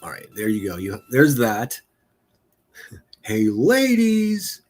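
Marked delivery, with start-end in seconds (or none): 3.2-4.6 very high, stretched ladies; high drawn-out teasing ladies; teasing call. Acted. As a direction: sing out the word like a wolf whistle. Teasing call to female viewers.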